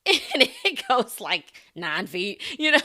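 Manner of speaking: loud, slow words pushed through laughter; laughing. Real, not acted. Player telling of her feeble kickball kick, laughing. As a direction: crack up at your own punchline.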